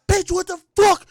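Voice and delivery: loud, high outburst at a partner; angry mock shout. Man shouting at his girlfriend through apartment walls, angry shout. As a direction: yell it like an argument overheard next door. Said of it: "if you fucking shout at a woman".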